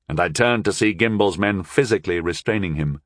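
plain reading voice

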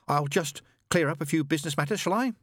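mildly desperate, rising to a high plea; politely angle for a delay, pitch climbing. Acted. Angel stalls the heavenly voice over business matters, desperately. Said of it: "said Aziraphale desperately".

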